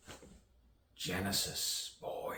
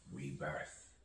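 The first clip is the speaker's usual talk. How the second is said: rebirth dropped back down, much softer; low, soft echo; 3 semitones lower, 9 dB quieter than usual. Acted. Poet closing on rebirth as two snakes, low soft echo. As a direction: let the echoed word sink away quietly. Echoed word in a poem.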